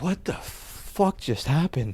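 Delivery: dazed. Retold or imitated. Waking up again, dazed soft disbelief. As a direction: drag it out, half-awake and stunned.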